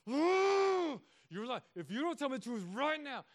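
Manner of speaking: hammed-up high angry outburst; mock wrath. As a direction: act out losing it at the kids. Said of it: "escalate my wrath".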